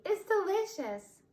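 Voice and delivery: a giggly stuffed bunny covering up; squeaky toy rabbit. A narrator's reading. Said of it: "said Ronnie".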